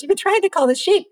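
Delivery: high, fast incredulous echo; amused rancher. Retold or imitated. The rancher to the tourists, high amused disbelief. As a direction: repeat it back in amused disbelief. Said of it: "And he goes".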